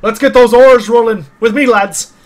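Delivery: loud, high call to the crew; hearty bellowed sea order; rallying shout. Acted. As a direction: rouse the lads to the oars. Sailor rallies crew to the oars.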